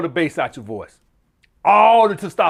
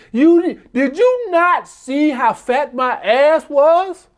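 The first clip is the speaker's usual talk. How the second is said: higher, drawn-out incredulous question; indignant woman imitation; 8 semitones higher, much slower than usual. Girlfriend complaining about his lack of interest, indignant. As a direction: put on her offended disbelief. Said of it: "That's basically what she's saying".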